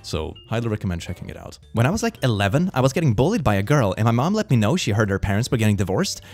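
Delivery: ordinary talk, nothing marked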